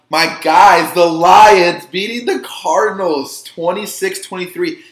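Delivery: loud, proud cheer for his team; excited. Real, not acted. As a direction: belt it out like a fan. Cheering his own team, the Lions.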